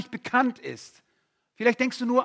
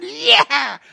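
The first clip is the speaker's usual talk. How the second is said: loud high shriek of joy; shrieked yeah of delight; joyful shriek; 11 semitones higher, 11 dB louder than usual. Shown as a demonstration. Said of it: "einfach nur kreischen".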